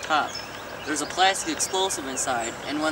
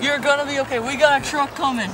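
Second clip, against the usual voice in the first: man down shout; 10 semitones higher, 5 dB louder than usual. Acted. Soldier comforts wounded man.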